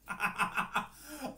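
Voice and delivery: high giggle bursting out; can't hold back the giggles; giggling. Real, not acted. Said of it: "I'm laughing because".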